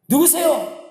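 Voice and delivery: high, urgent call to an unseen voice; acted calling out. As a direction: call up to whoever answered. Dangling man calls to voice. (높은 소리로 다급하게 묻는 연기)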